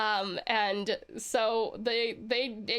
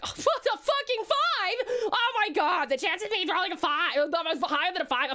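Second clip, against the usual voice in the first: reliving a bad roll; 5 semitones higher than usual. Streamer retelling her dice roll, mock panic. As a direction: squeal over a terrible roll of five. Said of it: "when I was like".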